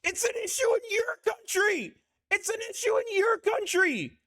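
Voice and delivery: high-pitched indignant outburst; heated retort. Real, not acted. Streamer retorting to the politician in the clip, heated. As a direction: fire it back, voice pitched right up.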